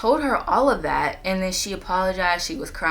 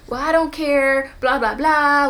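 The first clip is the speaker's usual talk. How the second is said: higher, louder dismissive mock voice; mocking imitation; 6 semitones higher, 6 dB louder, slower than usual. Imitating her friend's dismissive brush-off, mocking. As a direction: sneer it like a brush-off.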